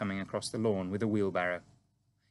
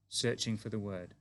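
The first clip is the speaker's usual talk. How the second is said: plain reading voice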